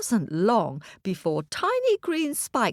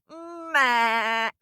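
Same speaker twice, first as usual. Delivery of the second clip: drawn-out bleat, raised in pitch; ram's bleat; 6 semitones higher than usual. Acted. Ram shoves the boy back, bleating. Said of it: "and the ram shoved".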